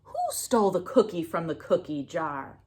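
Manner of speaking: plain reading voice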